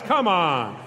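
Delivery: ordinary talk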